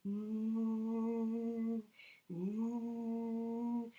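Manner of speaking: long steady engine mm drone; airplane hum. Shown as a demonstration. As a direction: hold an even mm like a jet engine. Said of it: "it sounds like".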